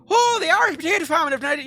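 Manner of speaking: loud, very high-pitched fake Irish accent; mock Irish accent. Classmates making fun of their Irish schoolmate, mock Irish accent. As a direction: jeer in an exaggerated Irish accent. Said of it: "mocking me in an Irish accent going".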